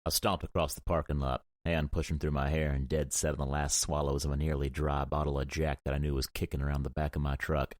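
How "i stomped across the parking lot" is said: ordinary delivery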